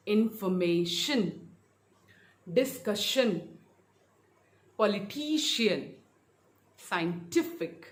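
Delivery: infor-MA-tion, dis-CUS-sion, poli-TI-cian, scien-TI-fic; deliberate demo of information, discussion, politician, scientific, penultimate beat stressed; second-last-syllable stress. Shown as a demonstration. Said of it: "second last syllable पर रहेगा".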